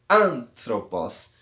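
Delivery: anthropos with AN held, then the rest; stress on AN. Shown as a demonstration. Teacher pointing out the tonic syllable, anthropos stressed on AN. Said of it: "vai ocorrer na an, que é a sílaba tônica".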